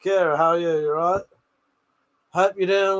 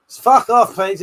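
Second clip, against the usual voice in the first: ordinary delivery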